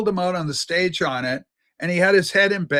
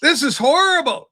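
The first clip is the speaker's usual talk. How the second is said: mock outrage; 8 semitones higher, 6 dB louder than usual. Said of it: "You'd go".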